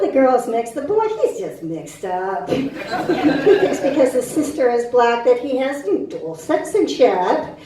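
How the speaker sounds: normal talking voice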